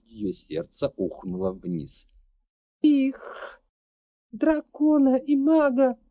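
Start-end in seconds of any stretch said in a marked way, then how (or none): 2.8-6.0 high, loud panicked cry; frightened shout. Acted. Frightened girl shouts answer.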